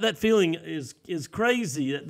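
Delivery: normal talking voice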